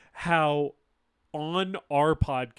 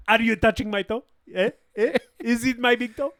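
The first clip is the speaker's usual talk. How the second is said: higher, questioning mock Romanian accent; Adrian Tofay; 5 semitones higher, 4 dB louder than usual. Spoofing the actor asking about his toe, in higher Romanian accent. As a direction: prod with eager questions, Romanian-accented parody. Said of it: "If it's Adrian Tofay".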